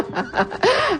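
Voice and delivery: petit rire aigu; rire spontané. Real, not acted. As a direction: bref éclat de rire haut perché. Rit en apercevant son collègue. (petit rire aigu et spontané)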